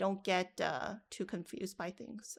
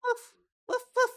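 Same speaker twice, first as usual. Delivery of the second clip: much higher, yappy woof like a small dog; dog bark imitation; 9 dB louder than usual. Shown as a demonstration. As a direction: bark like a little dog into the mic. Recording a voice, dog sound.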